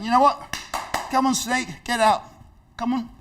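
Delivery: high, loud, brisk shooing of an imaginary snake; brisk carer. Retold or imitated. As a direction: play along and chase the snake out. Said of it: "She got up and she went".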